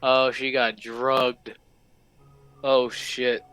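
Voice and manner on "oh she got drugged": ordinary delivery, nothing marked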